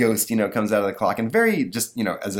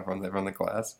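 ordinary talk

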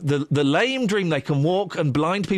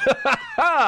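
laugh; 13 semitones higher than usual. Real, not acted. Host laughs at pretend caller idea.

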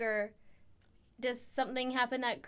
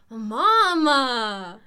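much louder shout of "Mama!"; mock angry Italian yell; 12 dB louder than usual. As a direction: yell for mom in a fake Italian rage. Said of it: "I talk Italian".

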